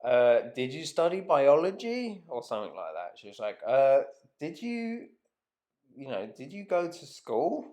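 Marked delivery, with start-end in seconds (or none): 0.0-2.2 higher-pitched, dissing question; put-on patronising query; mock condescending. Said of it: "kinda dissed me". 3.6-7.6 mock condescending. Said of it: "kinda dissed me".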